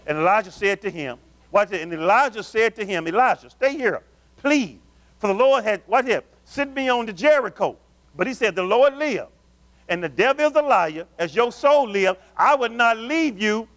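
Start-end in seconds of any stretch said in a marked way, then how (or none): 1.8-7.8 preached Bible reading, raised and slowed in places; fervent scripture reading. Acted. Preacher reading Kings to his congregation, fervent. 8.1-13.7 Elisha's vow "As your soul live, I would not leave you" preached raised and slowed; fervent preached vow. Acted. Preacher voicing Elisha's vow to Elijah, fervent. Scripture preached, later read plain.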